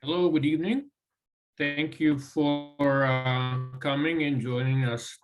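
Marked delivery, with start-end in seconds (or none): none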